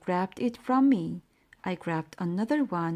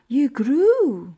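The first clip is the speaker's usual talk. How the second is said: much louder, startled cry; shocked yell; 5 semitones higher, 10 dB louder than usual. Acted. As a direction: blurt it out in alarm. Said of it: "I yelled".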